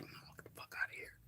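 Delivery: hushed whispered dismissal. Retold or imitated. People brushing off the Watchers' idea, whispered. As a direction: breathe it out under your breath.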